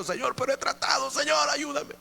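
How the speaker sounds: high, crying out to the Lord for help; desperate cry. Retold or imitated. Retold desperate cry to God. (clamor desesperado a Dios, agudo)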